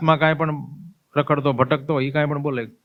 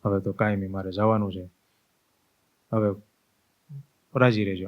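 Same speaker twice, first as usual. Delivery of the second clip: soft farewell; 3 semitones lower, 6 dB quieter than usual. Acted. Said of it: "બધાને હાથ જોડે".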